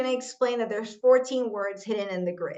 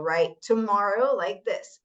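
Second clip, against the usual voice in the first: ordinary talk, nothing marked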